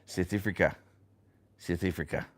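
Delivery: child's attempt at a South African accent. Parent quoting the oldest boy, mock South African accent. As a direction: imitate a little boy's South African accent. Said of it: "South African accent".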